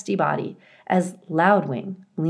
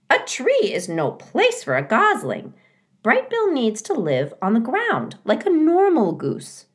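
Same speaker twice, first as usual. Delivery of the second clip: fussy goose voice; 7 semitones higher than usual. A narrator's reading. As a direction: rattle it off like a know-it-all goose. Goose insists.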